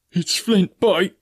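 high, slow panicked outcry; terrified cry. Acted. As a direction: cry out the dead captain's name in fright. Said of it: "cried Mary".

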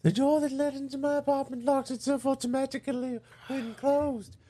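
put-on high old lady voice, a little British; quavering granny voice, hint of British. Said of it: "She's a little British".

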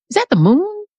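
lightly voiced dreamy dog, pitch swooping up; distracted dog. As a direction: play a dog lost in wonder at the sky. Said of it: "she's like".

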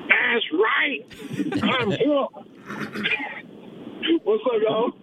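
high, gleeful greeting with a laugh; giddy, elated hello; very happy. Real, not acted. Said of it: "why do you sound so happy".

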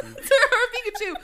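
high-pitched, laughing repeat; cracking up. Real, not acted. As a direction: read it through real laughter. Reader cracks up repeating the line.

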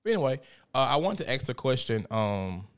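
usual speaking voice

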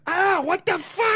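high, slow squeaky outrage; lobster voice. Pretend. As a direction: voice the shocked lobster. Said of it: "She might just be like".

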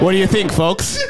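high-pitched mock stage appeal; mimicking comic. Podcast guest echoes the clip's comic, mocking imitation.

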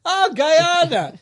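high, slow, drawn-out "oh"; sing-song surprised oh; mock surprise. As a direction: fake dawning realization.

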